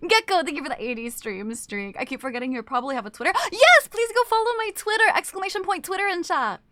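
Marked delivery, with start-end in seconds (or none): 3.4-6.6 excited plug. Real, not acted.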